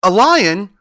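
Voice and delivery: high, loud cry from the mouse queen; shocked little queen, voice shooting up; alarmed cry. A narrator's reading. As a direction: squeak in alarm. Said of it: "cried the little Queen".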